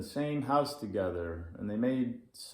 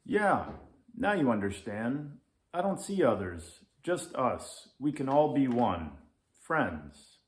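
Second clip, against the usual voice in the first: little Leo, eager; 5 semitones higher, 5 dB louder than usual. Acted. Little Leo explains oneness.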